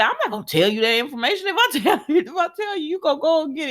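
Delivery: fast, high, giggly quote; tickled retelling, pitch climbing high; laughing mock refusal. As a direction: rush the refusal through laughter. Laughs through retold refusal.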